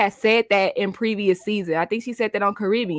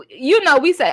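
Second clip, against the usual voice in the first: usual speaking voice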